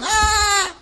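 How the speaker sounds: high mock fangirl squeal; fangirl scream. Preacher mocking thousands of fans with a squeal. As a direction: squeal like a starstruck fan. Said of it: "girls all going".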